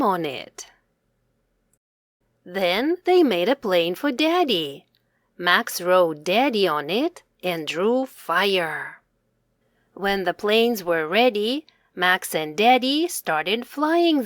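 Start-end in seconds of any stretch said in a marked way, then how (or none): none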